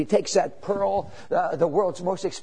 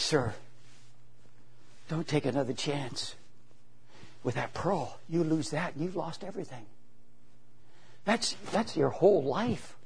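soft pleading; 3 semitones lower, 10 dB quieter than usual. Retold or imitated. Old lady pleads with juggler.

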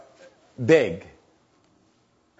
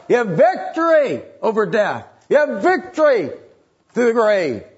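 preaching at full force; 5 semitones higher than usual. Acted. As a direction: lift the congregation toward the victory.

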